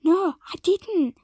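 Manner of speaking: loud denial. Acted. Heated denial.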